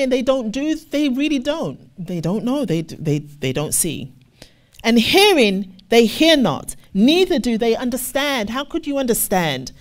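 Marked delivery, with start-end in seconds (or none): 4.8-8.5 scripture reading. Acted. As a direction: lean into each word of the verse. Reads scripture loudly.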